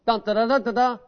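sung trumpet call, still unfinished; imitated trumpet call. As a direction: tease the trumpet tune, stopping short. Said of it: "that trumpet's gonna go".

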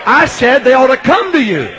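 high, loud call for amens; forceful preaching shout. Real, not acted. Preacher repeats himself to rouse the congregation, high forceful shout. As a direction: holler it at the congregation.

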